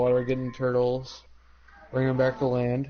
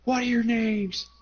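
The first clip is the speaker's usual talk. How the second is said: put-on old lady interrogating; 8 semitones higher than usual. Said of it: "she asks us".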